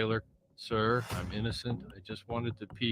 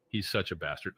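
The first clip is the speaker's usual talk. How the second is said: ordinary talk, nothing marked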